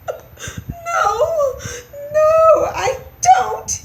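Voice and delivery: very high, tearful mouse squeal; wailing little mouse; unhappy squeak. A narrator's reading. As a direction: squeak it out loudly, close to tears. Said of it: "he squeaked unhappily".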